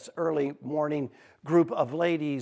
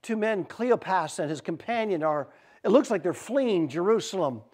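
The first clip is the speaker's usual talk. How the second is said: usual speaking voice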